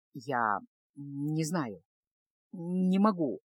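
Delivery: ниже и тише, устало и виновато; загнанный в угол юноша признаётся; подавленный Кайл. A narrator's reading. Кайл запинается. (ниже и тише, подавленный мужской голос)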